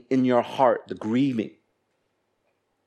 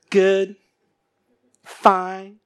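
high, loud stock answers; automatic pleasant reply; 8 semitones higher, 4 dB louder than usual. Acted. Preacher mimicking what people normally say, automatic pleasant reply. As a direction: chirp out the standard polite answer. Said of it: "What do we normally say?".

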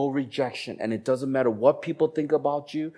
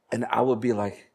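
ordinary delivery

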